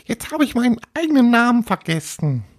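fast, very high ghost voice; exasperated little ghost. Acted. Little ghost forgot its own name, high dismayed voice. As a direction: squeak it out in a rush of dismay.

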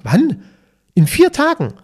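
high, loud disbelief; acted-out startled exchange; retold astonishment. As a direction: pitch jumps up as if stunned. Stunned exchange about short notice.

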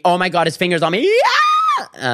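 laughing, then a very high startled yell; panicked shriek. Real, not acted. As a stranger's finger lands on him, a very high panicked shriek. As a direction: squeal as his finger touches. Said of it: "my heart is racing".

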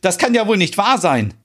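mild narrated shout at Stina; Merit's indignant outburst, understated. A narrator's reading. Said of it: "schreit Merit".

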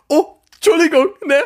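loud, high-pitched re-enacted startle; startled apology. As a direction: blurt an apology as if caught off guard. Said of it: "ich erschreck mich".

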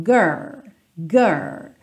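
put-on "Girl" held on the curled R, without the L; stopping at the R. Shown as a demonstration. Teacher isolating the R sound of "girl" step by step. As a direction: curl the tongue and stop before the L in "Girl". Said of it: "para nesse som".

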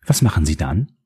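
sweetly polite on the surface, mocking; a touch of put-on friendliness. A narrator's reading. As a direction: fake a friendly tone. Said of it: "fragte sie gespielt freundlich".

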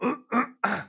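high rasping cough; coughing fit. Real, not acted. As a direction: cough hard, still choking. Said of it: "ich hab mich verschluckt".

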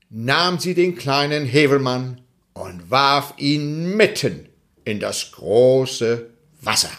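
plain reading voice

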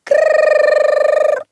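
high rattling alarm-clock ring; loud high mock alarm bell; alarm ring imitation. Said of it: "klingelte es laut".

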